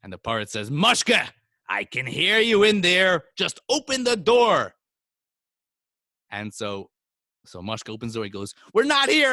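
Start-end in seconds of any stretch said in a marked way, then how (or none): 0.7-4.7 loud, high, insistent demand; raised voice, exasperated order; calling through door. Acted. Landlord shouts through shut door. 8.7-9.3 high, loud, hurried yell; frantic high-pitched shout; shouted denial. Acted. Mushka shouts denial at landlord.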